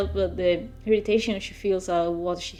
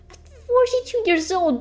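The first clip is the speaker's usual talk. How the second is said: mock-offended protest; 13 semitones higher than usual.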